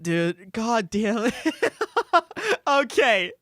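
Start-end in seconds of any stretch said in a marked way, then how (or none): 1.4-2.6 high laugh. Real, not acted. Emotional laugh at ending.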